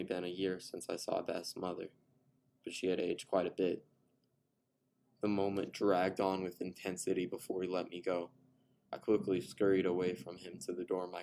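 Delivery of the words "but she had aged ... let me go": usual speaking voice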